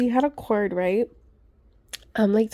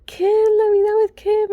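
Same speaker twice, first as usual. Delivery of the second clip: high, loud excited announcer voice; mock the host calling the winner; imitating Lori; 11 semitones higher, 5 dB louder than usual. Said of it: "And then she's like".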